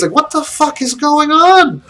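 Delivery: can't believe what you're seeing; baffled. Real, not acted. Baffled by opening sequence.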